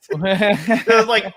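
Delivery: normal talking voice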